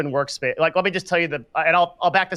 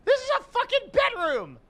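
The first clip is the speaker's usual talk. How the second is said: much higher, squealing repeat; shrieking outrage. Real, not acted. Streamer repeating the line in a higher shriek. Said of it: "it makes me so mad".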